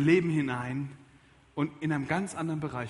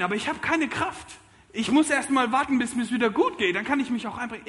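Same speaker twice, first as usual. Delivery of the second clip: higher, plaintive objection; whiny objection; 6 semitones higher, 4 dB louder than usual. Retold or imitated. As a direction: whine the excuse up high. Voiced objection, no strength. (hohe, jammernde Ausrede)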